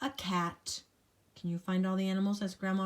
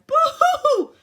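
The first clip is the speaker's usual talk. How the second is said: high, loud hammed-up boo-hoo with a laugh; mock sobbing rat, laughing; rat crying; 15 dB louder than usual. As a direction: wail like a silly crying rat. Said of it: "who cried".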